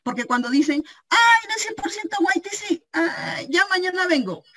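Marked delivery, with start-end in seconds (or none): 1.1-4.4 high, whiny mock customer brushing it off. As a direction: parody a shopper making excuses. Mocking hesitant customers. (imitación burlona y aguda de un cliente)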